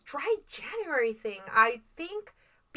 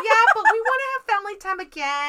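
quoting herself; 8 semitones higher, 6 dB louder, faster than usual. Retold or imitated. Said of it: "And I said".

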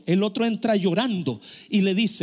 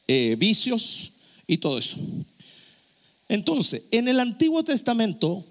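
ordinary delivery

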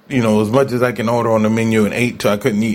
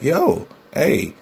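higher, cheeky craving voice; body's cravings talking; 6 semitones higher than usual. Retold or imitated. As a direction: voice your body begging for donuts and soda. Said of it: "my body was like".

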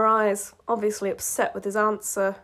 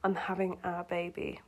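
hushed, much softer echo of a thought; quiet echo; 3 semitones lower, 11 dB quieter than usual. A narrator's reading. Marceline's words echo in Marshall's head, quietly. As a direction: let it sink in, very softly. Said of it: "it finally threaded through his brain".

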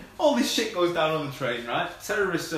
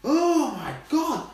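exasperated rant; 8 semitones higher than usual. Real, not acted. Ranting at the movie porter, exasperated yell. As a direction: yell at the screen in disbelief.

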